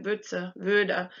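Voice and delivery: Würze, Würde with the rounded "hurt" vowel; two examples, Würze and Würde, fronted ü; German ü demo. Shown as a demonstration. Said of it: "sounds like the U in hurt".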